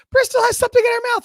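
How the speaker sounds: high-pitched, loud mock shriek; imitated frantic yelling. Imitating Kelly raising the alarm upstairs, high frantic yell. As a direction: yell it far up high, as if out the window. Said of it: "Kelly upstairs yelling".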